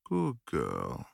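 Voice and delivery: ordinary delivery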